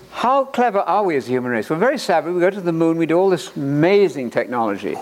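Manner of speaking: normal talking voice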